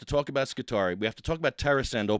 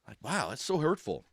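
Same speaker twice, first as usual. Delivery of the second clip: ordinary talk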